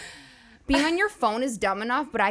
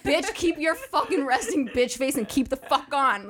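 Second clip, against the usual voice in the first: slow, higher tough-talk New Yorker voice; 4 semitones higher than usual. Retold or imitated. New Yorkers telling a transplant to keep her resting bitch face. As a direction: dish out some New York attitude. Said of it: "they're like".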